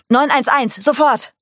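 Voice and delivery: loud, high-pitched urgent shout; bellowing. A narrator's reading. Mike shouting for an ambulance at the crime scene, bellowing. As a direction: yell it into the room, no time to lose. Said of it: "Brüllte Mike".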